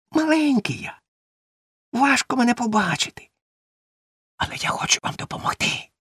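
small, squeaky creature speech; tiny cricket voice. A narrator's reading. Cricket offers help to the goat kids, tiny voice. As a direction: speak as a tiny chirping insect. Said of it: "запічний цвіркун".

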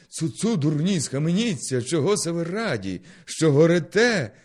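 loud, high indignant shout; angry rebuke. Acted. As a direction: scold the fools at full voice. Narrator scolds the cheering crowd. (гнівний гучний окрик на натовп)

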